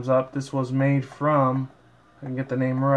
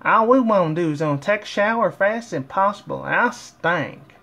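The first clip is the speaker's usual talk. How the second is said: Applejack voice; 6 semitones higher than usual. Acted. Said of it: "Applejack said".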